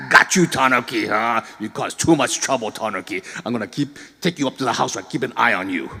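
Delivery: put-on gloating old grandfather; triumphant old man scolding. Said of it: "he come down and".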